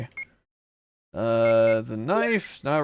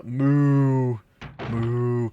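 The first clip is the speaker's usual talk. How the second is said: lightly mooing like a cow. Retold or imitated. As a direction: moo like a cow. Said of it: "sound like a cow".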